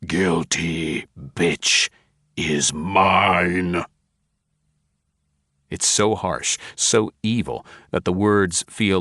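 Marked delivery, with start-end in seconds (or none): 0.1-3.9 deep guttural demon. A narrator's reading. Demonic voice cutting over Craghorn on the tape, slow and guttural. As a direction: drag each word out like an evil presence. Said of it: "a deep, guttural voice barrels in".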